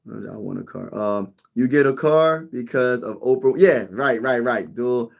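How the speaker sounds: normal talking voice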